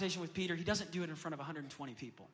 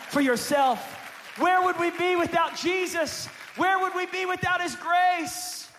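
loud, rising, climactic preaching; preaching at full force; 5 semitones higher, 10 dB louder than usual. Real, not acted. Pastor to congregation, full-force preaching climax. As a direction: lift the church with a loud call.

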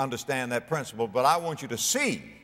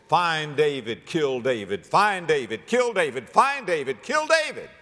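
repeated orders climbing in pitch; mounting manhunt cry. Acted. Preacher voices the kingdom's manhunt cry.

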